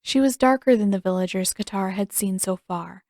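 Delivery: plain reading voice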